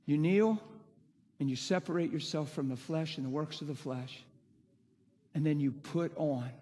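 ordinary talk

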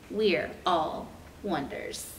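plain reading voice

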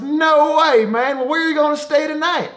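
high-pitched amazed mock voice, fast at the end; the driver asking where he'll sleep, high mock voice; imitating the trucker. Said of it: "And he goes".

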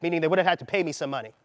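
normal talking voice

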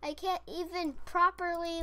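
usual speaking voice

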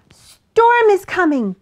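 high, loud, slow warning; animals' chorus of alarm. A narrator's reading. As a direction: cry the news out urgently. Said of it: "the animals told her all at once".

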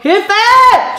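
high cry for help. Acted. Trapped voice calls for help.

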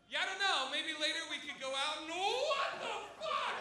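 mock girl's chatter breaking into high disbelief; imitated girl. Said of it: "you hear one girl just go".

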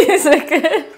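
loud, high laughing. Real, not acted. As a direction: laugh while asking. Laughing while asking.

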